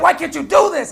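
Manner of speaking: hammed-up complaining wife; mock nagging wife. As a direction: whine it like a fed-up wife. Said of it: "She said".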